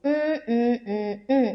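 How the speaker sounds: closed-mouth hums, one per syllable; humming the syllables. Shown as a demonstration. Teacher humming a word's syllables so students can count them. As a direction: hum each syllable with the lips shut. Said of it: "mouth बंद कीजिए और उस word को बोलिए".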